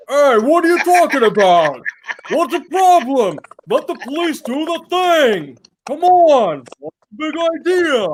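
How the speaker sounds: high, drawn-out Long Island accent; big hammed-up Long Island loudmouth. Shown as a demonstration. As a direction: stretch the vowels in broad Long Island. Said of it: "big Long Island accent".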